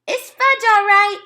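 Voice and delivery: put-on high, loud toddler parroting; a little kid copying his brother; toddler echoing. A narrator's reading. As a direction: toddler repeating Peter's words. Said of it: "my brother repeated".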